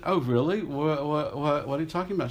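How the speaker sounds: mock innocence on the phone; lightly hammed innocence; feigned ignorance. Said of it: "I said".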